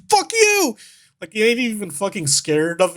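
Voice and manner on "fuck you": high mock-British yelp; annoyed British guy. Annoyed guy approaching the mimic, high mock-British shout. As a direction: hurl it back in a squeaky British accent. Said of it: "He's all".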